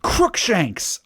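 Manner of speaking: high-pitched cry; mild shout. A narrator's reading. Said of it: "I shouted at him".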